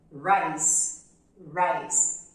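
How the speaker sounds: "rice" with a strong English r, not a throaty h. Shown as a demonstration. Teacher showing students the r of "rice". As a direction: start "rice" with a firm English r. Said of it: "preciso fazer um som de R".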